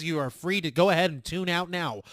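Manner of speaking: ordinary talk, nothing marked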